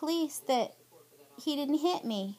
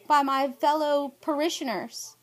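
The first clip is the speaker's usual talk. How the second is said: ordinary talk, nothing marked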